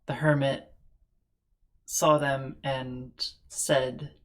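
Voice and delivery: normal talking voice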